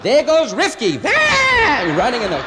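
high, loud excited shout; excited race call. Pretend. Imagined announcer at dog track.

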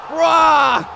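high, forceful roar; playful monster roar. Pretend. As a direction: roar while hurling someone. Roars while throwing.

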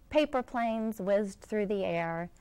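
ordinary delivery, nothing marked

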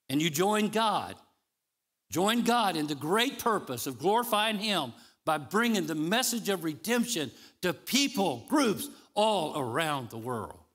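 high, loud rallying sermon voice; preaching exhortation. Acted. Preacher calling believers to join God, forceful exhortation. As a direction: urge them on forcefully, stretching the last phrase.

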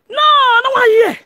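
high-pitched imitation of frantic denial; mock panicked denial. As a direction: mimic a high, alarmed refusal.

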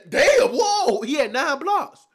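loud, high outburst then slow disbelief; stunned shout. Real, not acted. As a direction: yell in shock at the stat line. Stat reveal stuns him.

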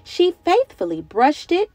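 lively, sing-song picture-book reading; reading to children. A narrator's reading. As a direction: read it like story time for little kids.

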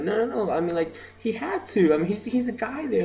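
high-pitched embarrassed protest, imitated; embarrassed friend's insistence, acted out; flustered backpedalling. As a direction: mimic a friend hastily explaining herself, pitch jumping up. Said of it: "she, she felt embarrassed".

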